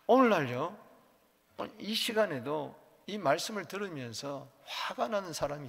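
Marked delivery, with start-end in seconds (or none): none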